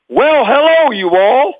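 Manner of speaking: high, projected Southern greeting; drill instructor voice. Acted. Boy actor on take one told to speak up, drill instructor voice. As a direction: belt it up to a far-off microphone with a Southern twang. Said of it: "I had a drill instructor voice".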